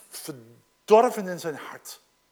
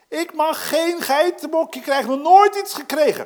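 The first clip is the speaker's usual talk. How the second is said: verwijtend klagen; 7 semitones higher, 6 dB louder than usual. Acted. Quoting angry eldest son.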